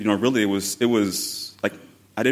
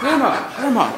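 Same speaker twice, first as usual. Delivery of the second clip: higher, laughing plea to grandma; urgent embarrassed call; 6 dB louder than usual. Retold or imitated. Grandson pleading with his grandmother on the lawn, embarrassed and laughing. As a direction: call her name, half laughing, half pleading.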